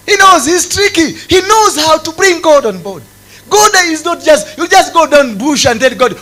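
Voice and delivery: high, loud sermon voice; full-force preaching. Acted. Preacher warning people how to approach God, in fervent shouting. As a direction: drive it home high and loud.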